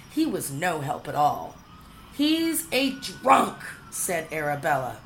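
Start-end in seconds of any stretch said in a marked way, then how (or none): 2.1-3.6 scornful cry. A narrator's reading. Arabella scornfully declares.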